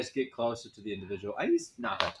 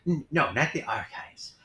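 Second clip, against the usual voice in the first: usual speaking voice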